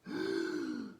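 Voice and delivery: noisy, tense gasp in. Shown as a demonstration. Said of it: "it sounds like this".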